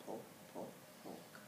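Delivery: hushed poke, poke, poke, fading; soft sound effect whisper. A narrator's reading. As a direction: tiptoe the pokes in a whisper.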